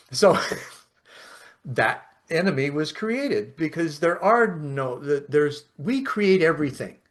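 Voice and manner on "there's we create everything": ordinary talk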